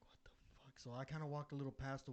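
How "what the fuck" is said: barely audible, under the breath; retold creeped-out whisper; spooked whisper. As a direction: whisper it in hushed disbelief.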